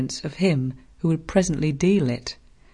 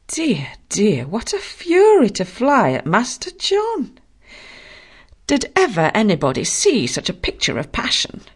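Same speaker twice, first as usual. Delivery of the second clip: whispered then high scandalized cry; a prim servant aghast; shocked maid; 6 semitones higher, 4 dB louder than usual. Acted. Maid exclaiming at the fight.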